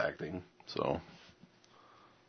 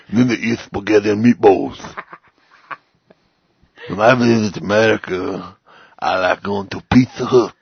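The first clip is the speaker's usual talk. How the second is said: bad Italian accent. Podcaster in character on visiting America, hammed-up Italian accent. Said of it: "do it in your best Italian accent".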